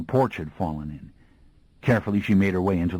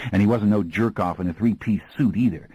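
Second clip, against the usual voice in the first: usual speaking voice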